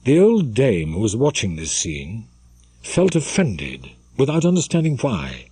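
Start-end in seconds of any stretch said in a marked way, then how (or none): none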